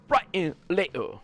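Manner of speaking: slow, hammed-up English accent; Andrew Tate impression. Streamer mocks his own Andrew Tate line in a hammed-up English accent. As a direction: drag out brighter later in a bad Andrew Tate accent. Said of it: "the worst impression ever".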